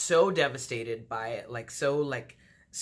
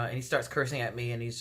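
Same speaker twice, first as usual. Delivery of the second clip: ordinary talk, nothing marked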